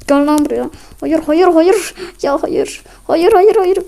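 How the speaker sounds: rising panicked shouts of no; frantic loud protest; panicked yelling. Real, not acted. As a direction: yell no as the fight turns against you. Panicked cries as the fight turns.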